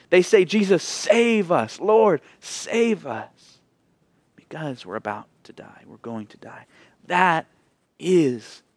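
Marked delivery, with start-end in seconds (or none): 0.5-3.6 slow pleading. Acted. Disciples crying out in storm. 4.4-6.7 whispered, much softer fearful plea; hushed words of men about to die. Acted. Disciples' plea fades to whisper.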